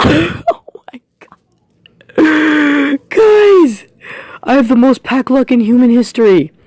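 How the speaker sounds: excited. Real, not acted. Gamer bragging about pack luck, high excited shout. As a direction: shout it to the viewers.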